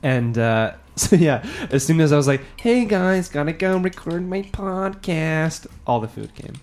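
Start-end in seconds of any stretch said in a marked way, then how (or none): 2.6-5.7 lightly put-on higher voice, slowed on the way out; airy sing-song farewell to the guys; mock goodbye voice. Mocking his own goodbye to the dinner crowd, put-on voice. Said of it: "I hope you said it in a better voice than that".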